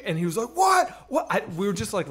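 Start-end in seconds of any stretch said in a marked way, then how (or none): 0.5-1.3 indignant reaction. Retold or imitated. Insulted gangster answering the kids' taunt, indignant. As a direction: imitate an insulted man's outraged question.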